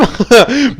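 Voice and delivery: short amused laugh. Real, not acted. Gamer amused mid-battle, short laugh. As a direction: let out a brief laugh mid-game.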